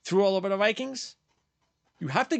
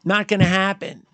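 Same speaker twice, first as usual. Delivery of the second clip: loud and final, shutting the idea down; emphatic loud; 7 dB louder than usual. Real, not acted. Angry host tells stubborn fans it's not gonna happen, emphatic and loud. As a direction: bark it out to end the argument.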